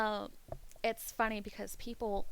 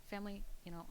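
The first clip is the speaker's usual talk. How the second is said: ordinary talk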